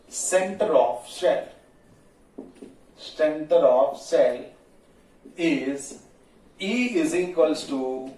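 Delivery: slow dictation with long pauses between phrases. Real, not acted. Teacher giving students notes on the shell's field, slow dictation. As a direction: dictate slowly, a few words at a time.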